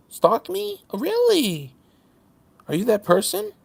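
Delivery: high mocking "oh really"; sarcastic disbelief. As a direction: needle him with fake shock. Taunting the retracting commenter.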